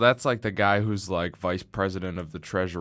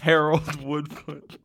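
slow, drawn-out posh pronouncing of Harold Woodfoot; slow, higher, savoring Harold Woodfoot; mock posh name; 7 semitones higher, 3 dB louder, slower than usual. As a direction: say Harold Woodfoot slow and grand, like high society. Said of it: "Harold's too high society".

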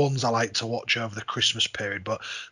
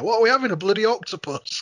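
higher, louder grumbling dad; grumpy Jim; 9 semitones higher, 5 dB louder than usual. Retold or imitated. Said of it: "and Jim's there".

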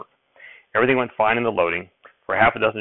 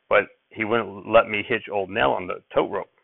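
ordinary delivery, nothing marked

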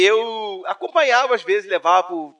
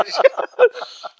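high laugh; 3 dB louder than usual. Real, not acted. Laughing while quoting the pastor.

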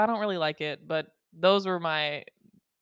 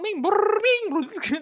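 much higher, louder mouth-made pinball noise; 5 dB louder, slower than usual. Retold or imitated. Showing listeners her ADHD mind, pinball noises. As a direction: play the machine with your voice. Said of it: "a full-on pinball machine".

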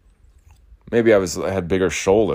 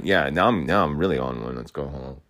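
normal talking voice

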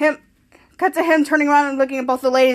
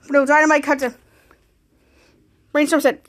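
ordinary delivery, nothing marked

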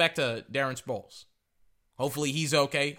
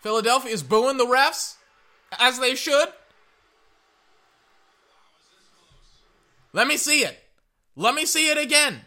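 worked-up fan; 11 semitones higher, 7 dB louder than usual. Real, not acted. Waiting for the replay, worked up.

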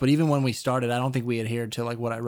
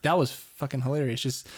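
ordinary talk

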